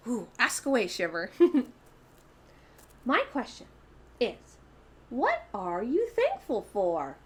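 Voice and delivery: a playful puppet asking questions; hint of a cute puppet. Puppet Shimmer voice.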